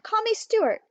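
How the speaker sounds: high, eager request; eager cry. A narrator's reading. Stuart asking his new friend to use his name, eager. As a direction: say it brightly, wanting closeness. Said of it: "cried Stuart".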